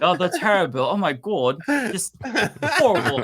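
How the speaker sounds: mock British disapproval, overplayed; bad British accent. Imagined small talk among her followers in a hammed-up British accent. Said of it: "I should do it in a British accent".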